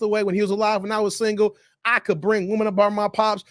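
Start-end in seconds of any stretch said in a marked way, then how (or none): none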